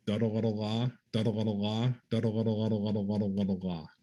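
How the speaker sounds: "Duddle, luddle, la" chanted in tonguing rhythm, "la" closing each group; duddle luddle drill. Shown as a demonstration. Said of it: "the very first thing he taught me was just go".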